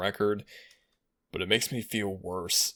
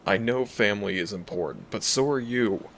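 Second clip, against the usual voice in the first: louder, urgent plea to a treasured human; protective dragon, worried; 2 semitones higher, 4 dB louder than usual. Acted. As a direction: play a concerned dragon shielding their companion. Dragon character worries over human companion.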